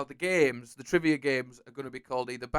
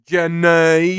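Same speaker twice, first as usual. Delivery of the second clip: loud, higher, drawn-out Southern cry; Forrest Gump's accent; 4 semitones higher, 11 dB louder than usual. Acted. Host copies the film character after the answer, Southern accent. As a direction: call the name in a mock Southern accent. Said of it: "If you try do it in his accent".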